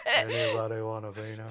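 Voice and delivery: Andre the Giant. Retold or imitated. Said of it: "I can't do it either".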